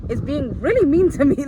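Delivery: venting hot about the teacher; heated outburst. Real, not acted. Telling viewers about the mean teacher, indignant and loud.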